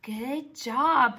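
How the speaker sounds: usual speaking voice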